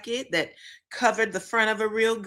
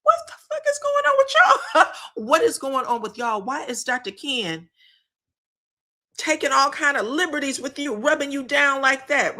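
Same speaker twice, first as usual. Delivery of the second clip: shocked outburst; 5 semitones higher than usual. Retold or imitated. Table guests reacting to the doctor, shocked and high. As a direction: shriek it in disbelief, then laugh. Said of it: "everybody was like".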